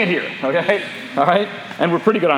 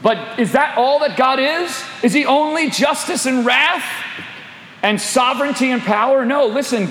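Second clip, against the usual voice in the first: preaching at full force; 8 semitones higher than usual. Acted. Preacher challenging the congregation about God, fiery. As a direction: lift the room with the question.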